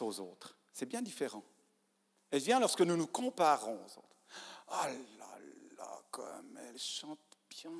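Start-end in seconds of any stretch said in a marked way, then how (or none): 4.7-7.7 hushed, unvoiced envious aside; envious whisper. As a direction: whisper it like a jealous thought. Envious inner comparison, whispered. (chuchoté, plein d'envie)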